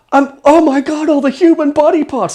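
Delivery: loud, high-pitched mock wail; hammed-up tearful horror; Fox crying. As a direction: wail it as if bursting into tears. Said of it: "suddenly he starts crying".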